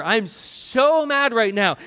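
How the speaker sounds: acted anger in prayer. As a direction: let the anger out as a demo prayer. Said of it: "Acknowledge to God your anger".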